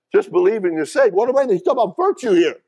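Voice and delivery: high, loud, fast preacher's cry; rising sermon fervour; preaching at full force. Acted. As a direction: drive the point home to the church.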